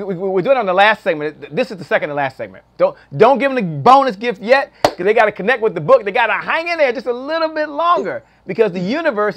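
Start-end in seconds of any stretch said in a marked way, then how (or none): 2.8-4.7 loud. Real, not acted. Host stopping guest from giving gift.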